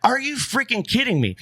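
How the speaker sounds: high, stunned disbelief; retold outburst of amazement, voice leaping up; incredulous. Hunter reliving a kill he couldn't believe, incredulous.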